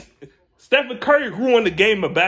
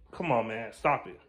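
usual speaking voice

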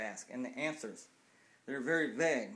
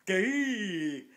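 mocking outburst; 5 semitones higher, 6 dB louder than usual. Mocking outburst about the sign.

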